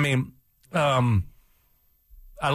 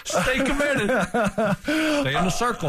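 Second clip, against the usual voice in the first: mock preachy; 10 semitones higher than usual. Mock loyalty chant.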